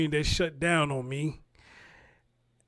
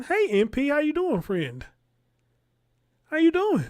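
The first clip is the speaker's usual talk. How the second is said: excited greeting; 9 semitones higher, 4 dB louder than usual. Real, not acted. Greeting a viewer by name.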